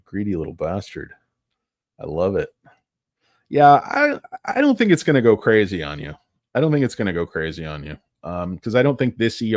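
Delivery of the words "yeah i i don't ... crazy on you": louder and higher, insisting; emphatic loud take; louder reassurance. Real, not acted. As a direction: push it out like a promise.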